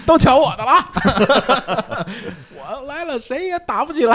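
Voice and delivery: high, slow, laughing peacemaker; hammed-up high mediator voice. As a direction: ham up the mediator arriving. Playing the mediator mom.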